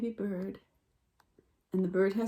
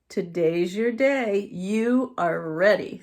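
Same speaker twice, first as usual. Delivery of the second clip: slow, louder encouragement; reassuring mama; 6 dB louder, slower than usual. A narrator's reading. Mother deer reassuring her fawn, slow and steady. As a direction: tell him gently and firmly he's ready. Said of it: "said Mama".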